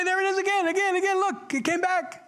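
high-pitched, acted burst of delight; overjoyed. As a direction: exclaim in joyful amazement, voice way up. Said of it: "they rejoiced with exceedingly great joy".

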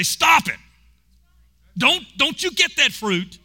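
high, loud, urgent stop; Adam shouting. Retold or imitated. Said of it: "Why didn't Adam say".

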